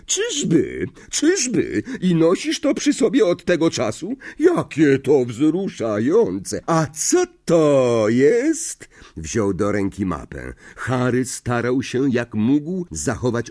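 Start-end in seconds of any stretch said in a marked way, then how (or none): 0.1-8.9 high, mocking drawl; sarcastic professor. A narrator's reading. Professor doubting a pupil's excuse, sarcastic. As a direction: stretch it out with mock sympathy.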